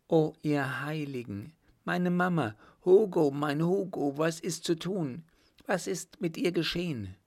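high, pleading woman's voice; distressed woman. Acted. Woman asking Hugo what happened to Mama, high and distressed. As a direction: cry out in worry, pitched well up.

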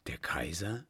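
awed whisper. Acted. Stunned at the news.

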